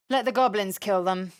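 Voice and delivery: usual speaking voice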